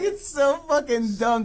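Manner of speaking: real amused disbelief; laughing. Real, not acted. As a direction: giggle out the complaint. Still laughing at scene.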